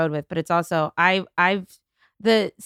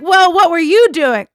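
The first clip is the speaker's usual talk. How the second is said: accusing dog owner; 7 semitones higher, 8 dB louder than usual. Retold or imitated.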